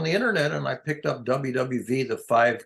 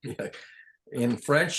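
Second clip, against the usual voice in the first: normal talking voice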